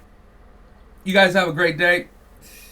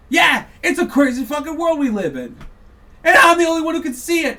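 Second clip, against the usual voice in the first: shouting rant; 7 semitones higher, 5 dB louder than usual. Real, not acted. Angry rant peak.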